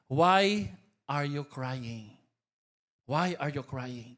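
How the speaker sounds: slow, low-pitched question; solemn quoted question. Retold or imitated. Reads the resurrection question out.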